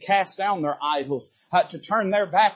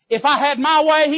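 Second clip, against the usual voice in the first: preaching burst; 9 semitones higher, 8 dB louder than usual. Real, not acted. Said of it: "emphatic preaching climax".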